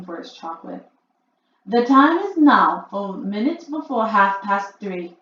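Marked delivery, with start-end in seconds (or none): 1.7-5.2 announcing. A narrator's reading. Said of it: "announced the Grand High Witch".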